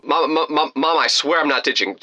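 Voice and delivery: stammering protest to mom; acted stuttering denial. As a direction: trip over the first word, then plead. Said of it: "I start stuttering out".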